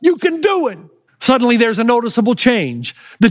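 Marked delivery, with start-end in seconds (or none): 0.0-0.8 high, urgent chant of fight; frantic yell. Retold or imitated. Father on his feet urging his son, frantic yelling. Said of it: "Dane gets on his feet and yells again".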